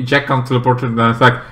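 normal talking voice

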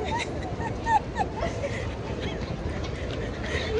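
airy laugh while running; breathless laugh. Real, not acted.

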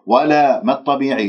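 normal talking voice

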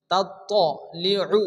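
slow তাত্তালিউ with a thick double t; heavy to. Shown as a demonstration. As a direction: hold the emphatic t of তাত্তালিউ, rounded vowel after it. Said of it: "ত হবে".

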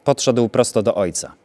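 usual speaking voice

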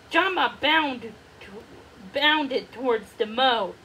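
ordinary delivery, nothing marked

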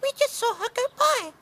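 very high squeaky mouse; squeaking mice. Acted. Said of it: "squeaked the mice".

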